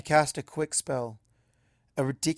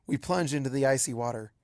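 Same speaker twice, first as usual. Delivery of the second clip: ordinary delivery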